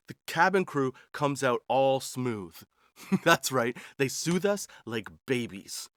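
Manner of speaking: ordinary talk, nothing marked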